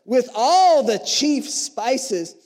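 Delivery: high, loud, slow and stretched out; impassioned reading. Acted. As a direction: pour it on. Reading the verse, building up.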